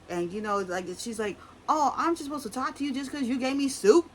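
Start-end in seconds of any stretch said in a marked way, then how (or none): 1.7-4.1 high, loud indignant retort; mock indignation. Imitating a housewife snapping back over soup. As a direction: throw the question back, offended.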